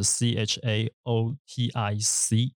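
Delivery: slow letter-by-letter spelling; spelled out. Shown as a demonstration. Host gives the spelling of the adjective, spelled slowly. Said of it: "拼法是".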